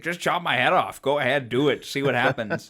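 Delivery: loud, cocky dare to be beheaded; mock taunt. Friend imitating the knight's cocky dare, loud and mocking. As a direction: jeer like a reckless knight.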